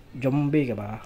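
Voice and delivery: ordinary talk, nothing marked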